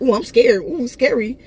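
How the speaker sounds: high, taunting fake fright; mock scared. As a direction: sneer it with pretend fear. He mocks her order to leave.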